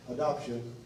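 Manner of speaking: usual speaking voice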